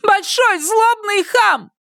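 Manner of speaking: slow, very high-pitched insult; high indignant woman's yell; outraged shout. A narrator's reading. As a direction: shout it high, stretching each word. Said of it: "крикнула женщина".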